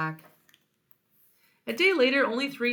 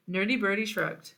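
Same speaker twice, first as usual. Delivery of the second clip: ordinary delivery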